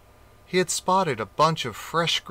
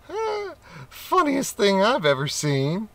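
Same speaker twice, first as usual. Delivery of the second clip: high, amused voice breaking into laughter; an onlooker mocks the leaping crocodile, laughing; 10 semitones higher than usual. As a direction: cackle at the sight.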